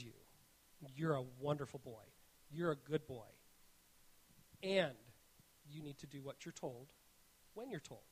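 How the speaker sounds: hushed fatherly comfort; tender reassurance. Retold or imitated. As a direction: gently tell your son he's loved. Father tenderly reassuring his son.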